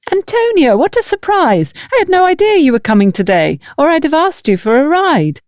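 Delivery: high, bubbly village woman, pleasantly surprised; cheerful sister-in-law. Acted. Bridget greets Antonia at the market, high and delighted. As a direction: voice Bridget bright and high, delighted to see her.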